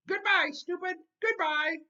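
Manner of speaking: very high, loud squawking bird call; mynah bird calling. A narrator's reading. The bird calling out as the boy leaves, high loud squawk. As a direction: squawk it out after him. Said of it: "Uncle Feather called out".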